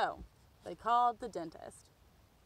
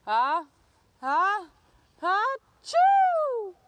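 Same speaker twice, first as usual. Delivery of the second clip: building sneeze; 10 semitones higher, 7 dB louder, much slower than usual. Acted. Andrew with pepper up his nose, sneeze building to a loud burst. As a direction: wind up a huge sneeze, then burst. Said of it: "Andrew went".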